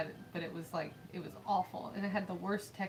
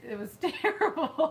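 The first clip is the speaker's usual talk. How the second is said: laughing; 7 semitones higher, 6 dB louder, slower than usual. Real, not acted. Laughing about awful cereal.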